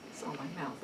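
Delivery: normal talking voice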